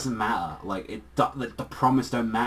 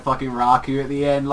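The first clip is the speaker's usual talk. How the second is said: ordinary talk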